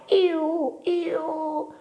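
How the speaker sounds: very high, drawn-out "ee-oo"; owl hoot. A narrator's reading. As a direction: imitate a night owl. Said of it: "la civetta che cantava". (verso acuto della civetta)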